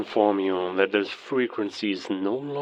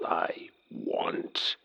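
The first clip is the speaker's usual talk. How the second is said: menacing whisper; 4 semitones lower, 6 dB quieter than usual. Acted. Villain ends his threat.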